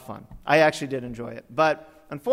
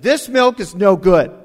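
loud, drawn-out customer grievance; indignant complaint; 4 semitones higher, 11 dB louder than usual. Retold or imitated. Customer bringing back old milk to the store, indignant. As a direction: complain loudly, stretching the words.

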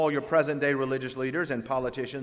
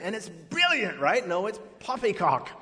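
a hint of a British accent; laughing mock British; 6 semitones higher than usual. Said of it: "say it with a British accent".

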